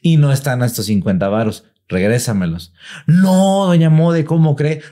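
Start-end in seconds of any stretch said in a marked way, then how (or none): 3.0-4.8 higher, mildly protesting denial; pleading maid. Retold or imitated. The maid denying the theft to doña Mode, higher and pleading. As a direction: protest your innocence to the lady.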